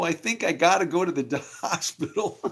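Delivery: half laugh it out; mock casual injured rider; injured self.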